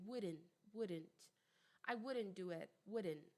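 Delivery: WOULDN'T with the D turned into a quick R-like tap; low, soft WOULDN'T, sounding like "wurren"; American tapped pronunciation. Shown as a demonstration. Teacher drilling for students, R-like tap in WOULDN'T. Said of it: "Vira como se fosse um R".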